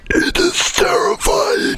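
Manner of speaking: slow, strained high whisper; dramatic whisper. Acted. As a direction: breathe it out in mock terror. Mock dramatic slow zoom scene.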